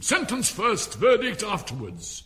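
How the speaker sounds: loud, high-pitched royal command; imperious Queen. A narrator's reading. Queen telling the court the order of trial, imperious shout. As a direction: lay down the law at full voice.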